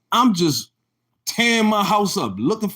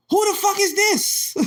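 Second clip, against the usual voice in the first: imitating an angry caller. Older woman calls him, voiced.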